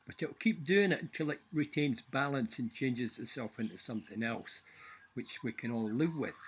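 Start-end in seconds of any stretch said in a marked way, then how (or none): none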